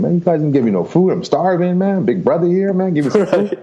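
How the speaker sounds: high, pleading mock yell at the door; half-joking hungry holler through a locked door; hungry yelling at guards. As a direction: bang on the door and demand food. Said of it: "I'm banging at one in the morning saying".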